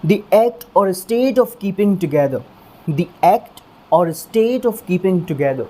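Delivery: definition read out slowly, word by word. Shown as a demonstration. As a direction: dictate the definition. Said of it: "पहला meaning क्या है देखिए".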